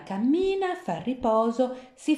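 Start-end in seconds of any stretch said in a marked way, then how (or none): 0.0-0.7 high lifted cammina. A narrator's reading. Repeated walking, high second.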